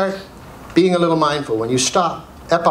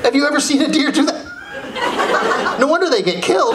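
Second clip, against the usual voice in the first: laughing; 8 semitones higher than usual. Real, not acted. Mocking the deer sign.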